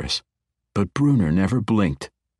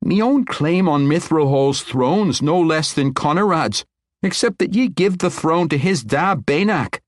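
blunt; 10 semitones higher, 4 dB louder than usual. Acted. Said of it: "she said bluntly".

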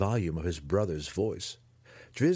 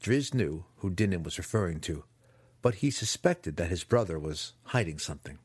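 ordinary delivery, nothing marked

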